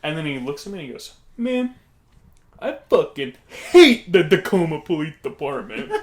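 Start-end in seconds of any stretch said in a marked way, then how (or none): 1.3-5.9 loud, hammed-up complaint with HATE blasted; emphatic customer impression. Odd stranger repeating his complaint to a diner, loud emphatic impression. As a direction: burst out louder, imitating the odd man. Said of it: "It's how he sounded".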